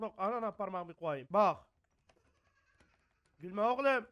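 ordinary talk

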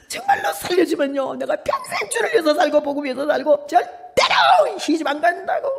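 high, slow begging with a laugh; desperate pleading vow. Retold or imitated. Young woman vowing to God on the mountain, begging high and slow. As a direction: plead breathlessly for your life.

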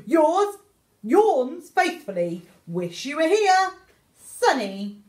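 plain reading voice

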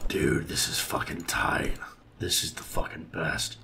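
slow hushed whisper; excited whisper. Real, not acted. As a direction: whisper it in awe. Awed whisper.

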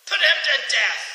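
very loud, high royal command; ordered. Acted. King ordering the villain's death, very loud. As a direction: roar the order. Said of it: "the king ordered".

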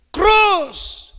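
high loud roll-call shout; calling out names. Retold or imitated. Announcer calling graduates up in the auditorium, shouting the name. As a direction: bellow the name across the hall.

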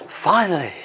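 breathy whispered finally, falling; whispered relief. Real, not acted. Whispering to viewers after finally finding the coat, relieved.

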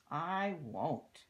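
loud storybook refusal; Annabelle Pratt. A narrator's reading. Voicing a naughty child refusing in a rhyme, loud refusal. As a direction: refuse firmly, projecting it as a read-aloud quote. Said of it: "says young Annabelle Pratt".

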